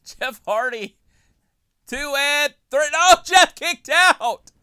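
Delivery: slow, loud, high drawn-out call; wrestling commentator. Acted. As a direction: stretch every word, yelling the call. Play-by-play of big move.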